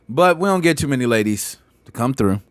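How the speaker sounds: normal talking voice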